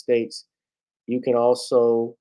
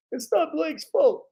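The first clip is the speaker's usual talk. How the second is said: slow, much higher coach voice for one last line; Doc Rivers impression. Vlogger repeats Doc Rivers' catchphrase slowly in a high voice. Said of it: "That's Doc Rivers".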